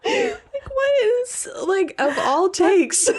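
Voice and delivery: high laughing squeal of disbelief. Real, not acted. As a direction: laugh through the words, amazed.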